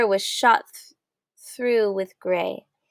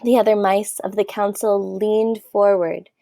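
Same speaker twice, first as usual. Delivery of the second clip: plain reading voice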